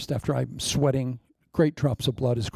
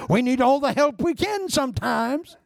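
high, put-on comic voice; jokey high-voiced self-excuse; high-pitched comic plea; 10 semitones higher than usual. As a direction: squeak it out way above your usual voice. Jokey excuse for grabbing beads.

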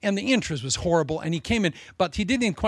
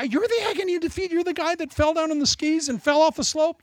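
mock announcer; 10 semitones higher than usual. Mocking how the world labels you.

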